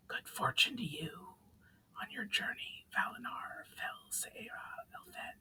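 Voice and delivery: soft, breathy whisper fading away; whispered farewell. Acted. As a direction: let the spirit's voice vanish into the fire.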